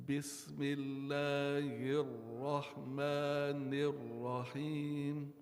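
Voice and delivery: slow chanted Bismillahirrahmanirrahim with emphasis kept; recitation with emphasis. Shown as a demonstration. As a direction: chant it slowly, stressing the marked points. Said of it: "So I want you to try that".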